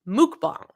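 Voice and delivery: "Mukbang" repeated as "mook-bahng"; correct pronunciation. Shown as a demonstration. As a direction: say MUKBANG with an oo, then an open ah. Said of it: "is how it's pronounced".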